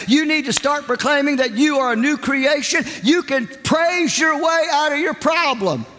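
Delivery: high, driving sermon rebuke; fiery preaching. Retold or imitated. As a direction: preach it at her, full force. Pastor preaching at the woman.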